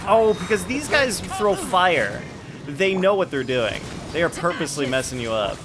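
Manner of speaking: usual speaking voice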